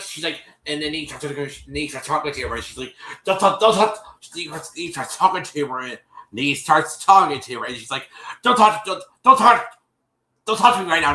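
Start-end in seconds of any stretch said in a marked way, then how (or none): 3.2-4.2 loud, high mimicked interruption; disrespectful shutdown. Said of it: "Just being really". 8.4-11.1 loud, high, repeated mimicked cutoff; disrespectful shutdown. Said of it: "being hella disrespectful".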